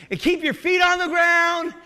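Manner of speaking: preacher shouting the saying; calling it out. Real, not acted. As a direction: belt the saying out to the congregation. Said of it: "anybody know what I'm talking about?".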